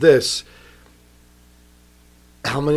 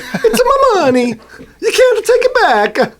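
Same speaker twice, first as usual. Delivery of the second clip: very high, loud, slow Mario voice; cartoon plumber voice, drawn out; 5 dB louder, slower than usual. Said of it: "why are you talking like that".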